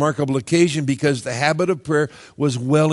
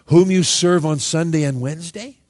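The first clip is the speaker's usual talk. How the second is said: questioning rise on "Sunday and Wednesday"; wry mock question; mocking misquote; 3 dB louder than usual. Shown as a demonstration. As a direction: tease the wrong reading with a rising question. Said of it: "Is that what it says?".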